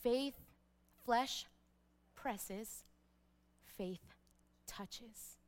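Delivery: each word set apart by pauses, "Faith, flesh presses, faith touches"; deliberate repetition. Shown as a demonstration. Preacher repeats the saying, word by word, fading on "touches". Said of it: "I'll say that again".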